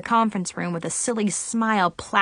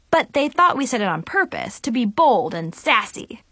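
ordinary delivery

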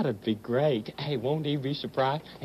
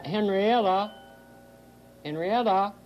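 raised, lilting call; 4 semitones higher than usual. Acted. Woman summoning the pussycat, lilting call. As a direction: call for her, pitched up. Calling the cat to come.

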